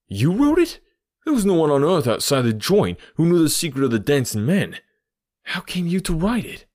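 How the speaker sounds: high, incredulous outburst; astonished. Acted. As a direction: leap up in pitch with startled disbelief. Culprit astonished at Holmes's admission.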